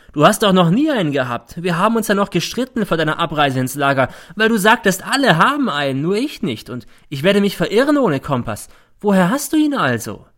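high, loud, hectoring mother; a mother nagging her son in front of everyone; accusing mother. A narrator's reading. Mother grilling son about the compass, high and loud.

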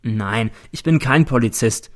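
ordinary delivery, nothing marked

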